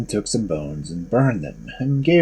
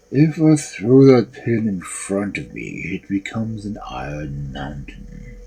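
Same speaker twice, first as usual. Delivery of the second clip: ogre voice; 4 semitones lower than usual. A narrator's reading.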